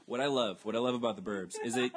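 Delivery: normal talking voice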